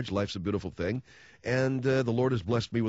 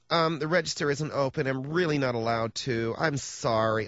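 fake apologetic clerk. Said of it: "she's giving you that real disingenuous smile".

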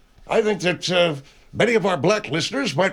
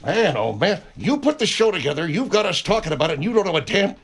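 usual speaking voice

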